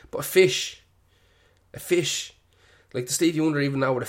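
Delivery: high, loud, disbelieving "a fish"; incredulous. Real, not acted. Ranting on air about a blind man's fish album, incredulous and high. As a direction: pitch it up in mock amazement.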